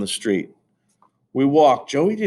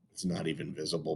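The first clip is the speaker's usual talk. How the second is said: plain reading voice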